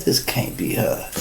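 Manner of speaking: whispered doubt; hushed disbelief. Retold or imitated. Officers doubting the classy wife did it, hushed whisper. Police doubting it's her.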